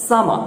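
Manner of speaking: সামা ending on an open vowel; silent final r. Shown as a demonstration. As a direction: drop the r at the end of সামা. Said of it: "রটা আমরা শেষে উচ্চারণ করব না".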